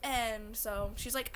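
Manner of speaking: ordinary talk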